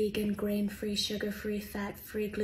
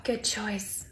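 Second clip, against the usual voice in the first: normal talking voice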